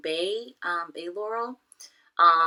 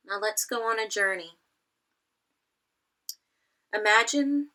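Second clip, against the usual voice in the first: measured meditation guide with long pauses; guided meditation; 3 semitones higher than usual. Acted. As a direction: lead them gently through it, phrase by phrase.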